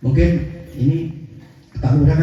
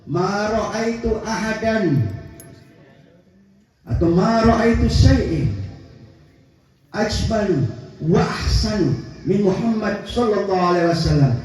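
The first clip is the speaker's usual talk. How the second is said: hadith recitation; 4 semitones higher, slower than usual. Acted.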